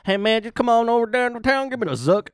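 high loud silly hype voice; goofy hype man. Pretend. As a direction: shout it like a goofy hustler.

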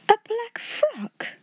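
very high, slow, tremulous echo; flustered stammer. A narrator's reading. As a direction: echo it in timid bewilderment. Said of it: "Miss Amelia stammered again".

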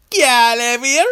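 Jamaican character voice. Acted. Third contestant, Jamaican character.

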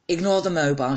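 ordinary delivery

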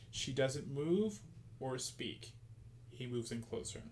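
sultry narration. Acted. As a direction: read it like a steamy novel, softly. Reading romance scene, slow and hushed.

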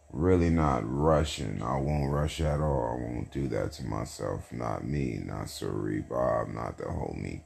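fading into a lazy murmur; quiet. Real, not acted. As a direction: let it drift away softly.

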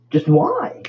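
very high squeaked why; pleading disbelief at the absurdity; exasperated why. Real, not acted. Vlogger giving up on the crown story, squeaky incredulous cry. Ranting about giant Burger King crowns.

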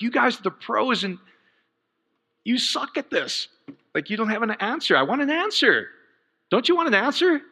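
demanding answers from the pros, fed up; frustrated. Retold or imitated. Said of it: "I'm getting frustrated even thinking about it".

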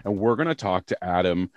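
ordinary talk

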